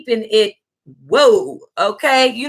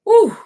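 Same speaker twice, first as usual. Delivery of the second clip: loud high whew; 12 semitones higher, 5 dB louder than usual. Real, not acted. Catching breath after laughing fit.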